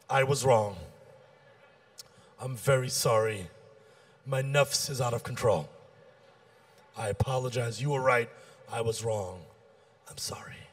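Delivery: low and soft, fading to a whisper; humble apology. Acted. Models a humble apology, fading away.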